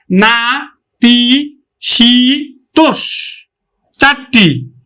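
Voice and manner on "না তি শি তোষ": না, তি, শি, তোষ as four separate beats; distinct, evenly spaced না, তি, শি, তোষ; syllable-by-syllable count. Shown as a demonstration. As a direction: give না, তি, শি, তোষ one syllable at a time. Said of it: "কয় প্রয়াসে উচ্চারিত করেছি".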